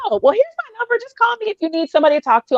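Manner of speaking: high, quick, chirpy offer; imitating her naive younger self; eager helper. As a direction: rattle it off helpfully in a high voice. Acts out offering her number.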